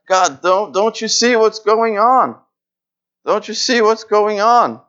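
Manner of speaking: high, pleading, slow plea to God; pleading cry. Retold or imitated. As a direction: beg God to look. Habakkuk's anguished plea to God.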